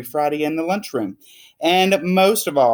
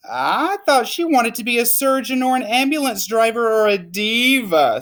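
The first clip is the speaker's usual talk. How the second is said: bemused father; 8 semitones higher than usual. Acted. Father lists careers.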